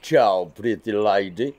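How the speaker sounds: slow, low hammed-up Italian pizza-man accent; comic Italian waiter voice; fake Italian accent. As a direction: lay on a cheesy Italian accent. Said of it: "They just forced me to do the accent".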